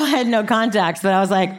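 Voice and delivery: usual speaking voice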